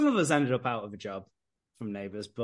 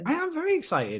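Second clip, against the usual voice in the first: high, emphatic "very excited"; 14 semitones higher than usual. Acted. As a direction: push the excitement up. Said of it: "you sound excited though".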